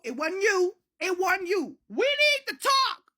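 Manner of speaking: high, loud, urgent outburst; acted frantic insistence. Narrator telling granny they need to talk, frantic shouting. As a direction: push it out in a hammed-up panic.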